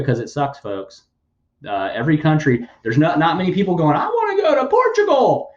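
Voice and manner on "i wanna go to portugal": very high, mocking sing-song voice; high mocking parody of a would-be emigrant; mocking whiny quote. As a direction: sneer at them in a squeaky put-on voice. Mocking people who say they'll leave.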